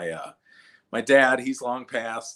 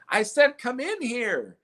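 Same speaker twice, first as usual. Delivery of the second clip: mock loudspeaker; 13 semitones higher, slower than usual. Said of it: "going over the loudspeaker".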